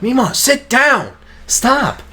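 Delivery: high, slow coaxing; pleading with grandma. Retold or imitated. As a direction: coax grandma to sit down. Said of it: "we're like".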